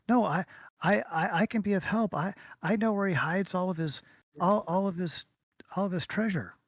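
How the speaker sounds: put-on high, stammering little-girl voice; scared small girl. Captured girl bargains with the party, high eager stammer. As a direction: offer help nervously, tripping over words up high. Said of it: "she says".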